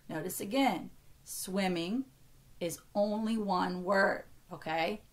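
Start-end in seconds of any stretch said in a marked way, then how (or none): none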